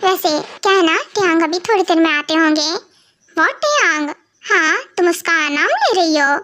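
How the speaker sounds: normal talking voice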